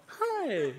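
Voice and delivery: high, sugary little hi; fake-sweet teacher hi. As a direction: answer with a put-on teacher smile. Teacher greets back.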